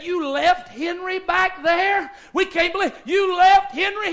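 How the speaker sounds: acting out shocked buddies; incredulous disbelief. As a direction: stretch the question in mock disbelief. Buddies react to abandoned friend.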